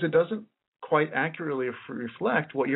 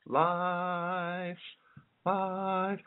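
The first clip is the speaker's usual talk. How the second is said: "Life" sung out high and soft, held long; raised life; 5 semitones higher, 6 dB quieter than usual. Shown as a demonstration. Teacher shows students how "life" rises easily, high and soft. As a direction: lift "life" up high and float it softly. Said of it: "it's easier to raise the life word".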